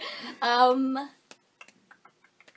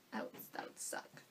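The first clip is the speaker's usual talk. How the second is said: whispering; 13 dB quieter than usual. Real, not acted. Aside about bad job.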